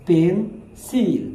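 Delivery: Pencil in two slow, separate beats; split into syllables. Shown as a demonstration. Teacher shows students how the word breaks up, pencil syllable by syllable. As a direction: say pencil very slowly, pausing between পেন and সিল. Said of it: "দুইবার উচ্চারণ করা লাগছে".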